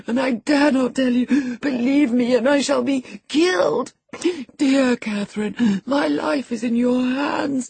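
high, gasping, tearful confession; sobbing. A narrator's reading. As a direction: sob desperately between phrases, begging for mercy. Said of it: "he sobbed".

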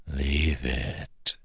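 soft, drawn-out eerie whisper. A narrator's reading. Hidden Kelsier orders the frightened woman, whispering. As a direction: whisper it slowly from the shadows. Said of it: "Kelsier whispered".

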